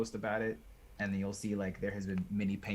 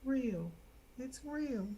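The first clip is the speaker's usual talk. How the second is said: amazed; 13 semitones higher, 5 dB quieter, much slower than usual. Real, not acted. Amazed at mini, high voice.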